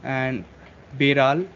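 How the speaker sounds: ordinary talk, nothing marked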